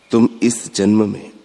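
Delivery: ordinary talk, nothing marked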